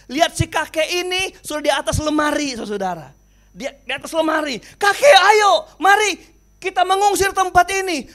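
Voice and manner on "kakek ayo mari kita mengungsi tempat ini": calling out. Acted. Rescuer urging grandpa.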